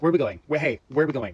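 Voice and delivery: retold panic, loud calling; panicked calling out. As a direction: call out frantically to the whole plane. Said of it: "running up and down the cabin being like".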